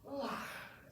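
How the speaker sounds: high, soft breathy dog sigh; imitated dog sigh. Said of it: "the dog will then sit down and go".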